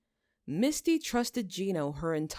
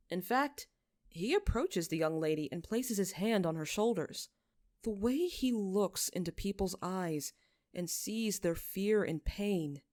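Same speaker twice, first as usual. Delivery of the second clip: usual speaking voice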